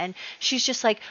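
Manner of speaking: usual speaking voice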